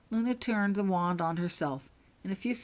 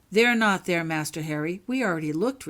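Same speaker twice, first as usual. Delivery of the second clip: ordinary delivery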